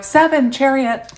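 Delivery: loud, high, excited call-out; full of energy. Real, not acted. Reader calling out the card, full of energy. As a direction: belt it out high and drawn out. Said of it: "I am so full of energy".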